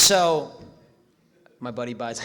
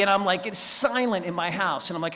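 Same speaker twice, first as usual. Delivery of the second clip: normal talking voice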